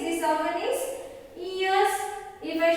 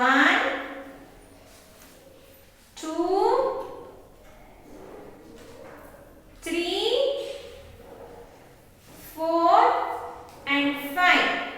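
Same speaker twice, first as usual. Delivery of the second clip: slow counting aloud with pauses; teaching a child to count; much slower than usual. Shown as a demonstration. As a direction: count each bird out for the kids. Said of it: "Can you count with me?".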